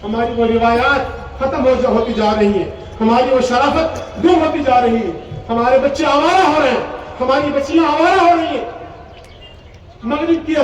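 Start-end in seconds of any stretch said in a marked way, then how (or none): none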